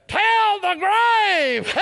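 very high, long-held shout; triumphant cry. Real, not acted. Preacher calling out the victory song, triumphant cry. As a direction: cry it out high, stretching every word.

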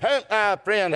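ordinary talk, nothing marked

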